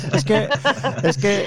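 high, laughing through the words; can't stop laughing while talking. Real, not acted. Bursts out laughing on air. (hablando entre risas)

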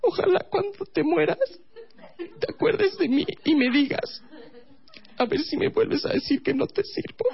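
put-on very high, bitter girlfriend voice; resentful girlfriend. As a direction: lay on the guilt in a high girlfriend voice.

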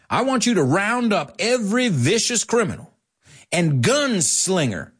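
high, theatrical order to a henchman; commanding villain. Acted. Hedley orders Taggart to round up criminals, hammed-up command. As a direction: declaim it grandly, pitched well up.

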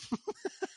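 breathy held-back laugh; a laugh escaping through the nose; stifled laugh. Real, not acted. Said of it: "No te rías".

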